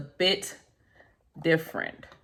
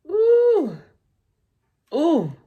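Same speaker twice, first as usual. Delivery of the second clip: delighted high ooh; 12 semitones higher, 6 dB louder than usual. Real, not acted. Admiring a tarot card.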